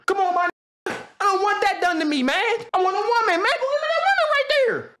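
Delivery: high, whiny hammed-up objection; mock pleading protest. Patient asking doctor for a woman instead, mock high protest. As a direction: plead with rising pitch up to a squeal.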